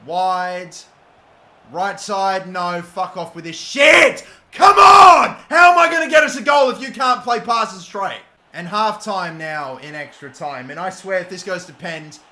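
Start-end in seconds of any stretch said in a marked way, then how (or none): 2.5-8.2 furious shout. Real, not acted. Gamer furious at a wayward pass, shouting. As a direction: let it explode at the bad pass.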